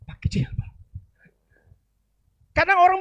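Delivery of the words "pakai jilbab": whispered, half-hidden remark; whispered aside. Retold or imitated. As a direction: drop to a whisper as if not to be overheard. Aside about neighbour. (bisikan pelan)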